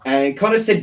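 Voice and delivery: ordinary talk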